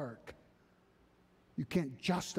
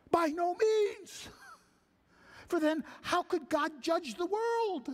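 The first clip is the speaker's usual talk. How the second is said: Paul's rebuttal. Acted. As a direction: refuse it flatly as the apostle.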